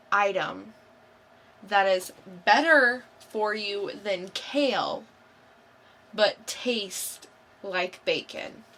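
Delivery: normal talking voice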